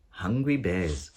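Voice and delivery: ordinary delivery, nothing marked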